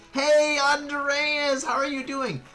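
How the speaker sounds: high, loud drawn-out hello; excited greeting. Real, not acted. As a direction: call out long and high to a friend. Greets a viewer joining stream.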